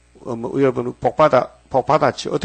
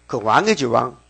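usual speaking voice

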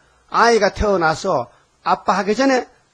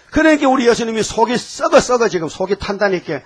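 usual speaking voice

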